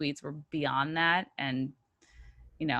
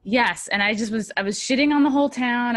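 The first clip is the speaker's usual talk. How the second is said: usual speaking voice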